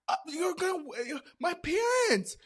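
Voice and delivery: high, loud, stammering protest; imitated yelling. Friend worried about parents hearing, yelled high. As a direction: stumble over it in a high yell. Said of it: "her yelling also was doing something".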